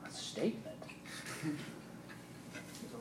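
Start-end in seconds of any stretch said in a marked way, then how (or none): 0.1-0.8 soft breathless question; whispered disbelief. Retold or imitated. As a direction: whisper it, stunned. Bewildered in interrogation.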